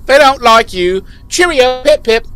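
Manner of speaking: high, loud posh British send-off; mock British accent. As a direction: chirp it like a cartoon Brit. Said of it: "Giles voice continues".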